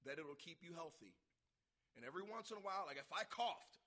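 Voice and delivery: ordinary talk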